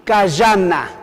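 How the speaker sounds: cayana slowly, syllable by syllable, with a 'y' sound; drawn-out teacher's cayana; pronunciation demo. Shown as a demonstration. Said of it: "Así".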